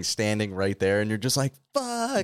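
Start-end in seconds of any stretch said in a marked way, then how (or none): 1.7-2.3 high panicked curse; panicked yelp. Retold or imitated. Panicked reaction.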